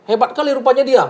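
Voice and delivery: imitated bullying outburst; mock snapped rebuke. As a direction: re-enact an arrogant telling-off, pitched well up. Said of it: "Kok saya dibentak-bentak ya".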